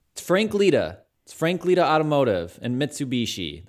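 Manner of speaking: high, loud hammed-up Italian Bronx car ad; fake Italian Bronx accent. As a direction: overdo a cheesy Bronx Italian ad voice. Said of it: "I'll do the impression and everything".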